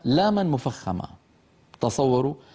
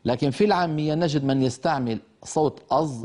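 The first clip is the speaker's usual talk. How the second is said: ordinary talk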